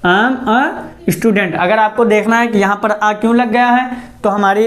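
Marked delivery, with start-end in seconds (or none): 0.0-1.6 am a student, each word drawn out very slowly; slow dictation. Shown as a demonstration. Teacher models the sentence slowly for the class. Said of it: "तो हम क्या बोलेंगे?".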